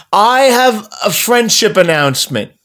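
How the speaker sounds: slow, high, deliberate proclamation; grand announcement. Retold or imitated. Daughter addressing dinner guests, mock-formal announcement. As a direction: announce it as a child would, ceremoniously.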